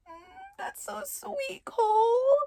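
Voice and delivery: very high, wobbly fake crying; hammed-up sobbing over something sweet; mock teary. [name] moved by Cole's gesture.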